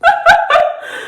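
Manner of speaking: real high-pitched giggling laugh; high giggle. Real, not acted. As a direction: giggle in a high voice, amused by your own story. Laughs at the [name] line.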